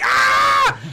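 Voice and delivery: high, loud mock shriek; sudden pretend scream of terror; imitated scream. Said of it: "she screams".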